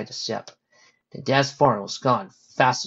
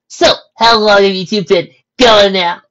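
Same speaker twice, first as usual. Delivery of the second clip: loud, high, stretched-out question; teasing; 9 semitones higher, 14 dB louder, slower than usual. Acted. Rainbow teasing two friends as a couple, loud teasing. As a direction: tease them slowly at full volume.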